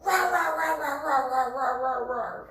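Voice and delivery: loud, high "rah rah" tirade, falling in pitch; imitated commander's rant. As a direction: imitate an officer ranting in wordless rahs. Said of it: "as a brigade commander would do".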